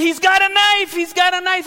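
high, loud panicked cry; panicked yelling. Retold or imitated. Sister yelling that the intruder has a knife, panicked shout. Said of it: "she's yelling".

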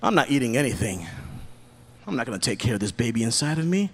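low, soft, fast sullen voice; low quick dismissive mom; careless mom. Retold or imitated. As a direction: mutter it like you don't care. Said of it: "go back home and be like".

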